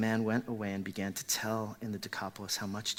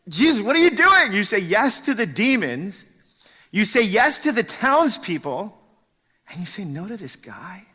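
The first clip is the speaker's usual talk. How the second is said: much higher and louder, exasperated protest; frustrated outcry; 8 semitones higher, 11 dB louder than usual. Acted. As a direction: throw the question up at Jesus in disbelief. Preacher voicing frustrated outcry at Jesus.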